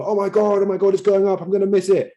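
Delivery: hammed-up high fomo gushing; fomo panic. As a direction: act out a buyer scared of missing out. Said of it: "They just buy into the fomo".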